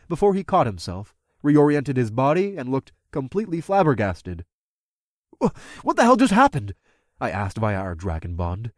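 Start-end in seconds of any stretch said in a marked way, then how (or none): 5.4-6.7 shocked. Acted. Shocked question.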